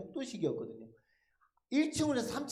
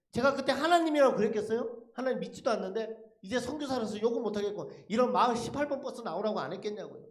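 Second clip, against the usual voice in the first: ordinary delivery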